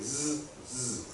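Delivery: soft Z, Z buzzed twice as zzz; two quiet, separate buzzes for Z; Z as a bare soft consonant; letter sound Z. Shown as a demonstration. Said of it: "say it".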